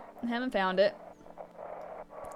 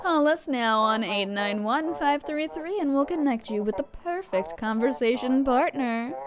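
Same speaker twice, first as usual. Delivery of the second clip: higher-pitched hotline sales pitch; radio ad read; 3 semitones higher, 4 dB louder than usual. Acted. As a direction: sell it like a radio spot. Voices game's radio ad.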